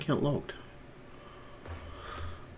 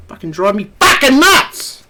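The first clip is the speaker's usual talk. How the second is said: much higher, louder, slow angry yell; furious outburst; 10 semitones higher, 5 dB louder than usual. Real, not acted. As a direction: spit it out at the screen, fed up. Ranting at Facebook.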